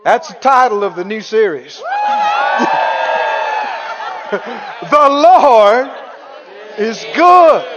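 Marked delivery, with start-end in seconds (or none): 1.9-4.3 high joyful whoop of a laugh. Real, not acted. Preacher laughing at his own shouted title, high whoop. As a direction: let out a high gleeful whoop.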